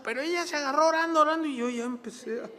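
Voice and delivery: high, hurried run of words; imitating nonstop praying. Preacher mimics his girlfriend praying on and on, hurried.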